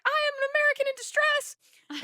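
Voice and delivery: loud, very high hammed-up cry; dramatic distress. Traveler phoning front desk, melodramatic high wail. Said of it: "this is just so dramatic".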